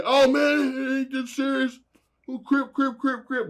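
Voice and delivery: high, pleading backpedal; backing down. Retold or imitated. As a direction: talk them down fast, hands up.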